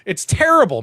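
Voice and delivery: loud, high emphatic verdict; exasperated insistence. Real, not acted. Arguing the movie is bad.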